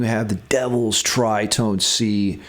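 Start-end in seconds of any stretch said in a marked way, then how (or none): none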